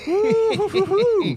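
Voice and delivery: high, slow, drawn-out whoop. Real, not acted. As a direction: whoop in triumph. Cheering a success.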